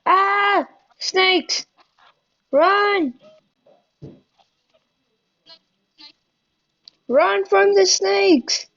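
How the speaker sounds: high panicked yelling; mock panic yell. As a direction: yell to run, high and slow. Fleeing snakes, yelling.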